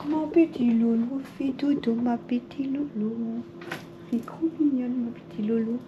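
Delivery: high, soft cooing lullaby voice. Real, not acted. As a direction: coo gently so she sleeps. Lulling sleeping cat softly.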